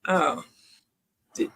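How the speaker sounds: ordinary delivery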